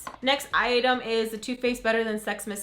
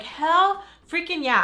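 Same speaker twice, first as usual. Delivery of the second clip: higher, louder and slow; hyped exclamation; 8 semitones higher, 5 dB louder, slower than usual. Retold or imitated. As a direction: stretch it out like a thrilled cheer. I'm like.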